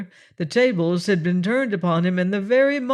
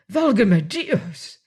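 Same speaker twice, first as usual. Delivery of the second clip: slow, anguished exclamation; a frightened Spaniard's slow cry; 2 semitones higher, 3 dB louder, slower than usual. A narrator's reading. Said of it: "cried out like a man in anguish".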